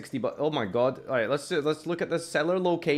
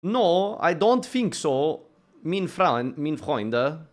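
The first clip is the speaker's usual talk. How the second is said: put-on higher, slow second try at a German accent; 4 semitones higher, much slower than usual. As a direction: ham up a German saying no. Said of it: "Can't do German accents".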